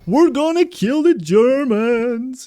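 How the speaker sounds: high, slow, pumped-up hype; rally the squad, all fired up; hyped battle cry. Real, not acted. Hyped gamer cheer.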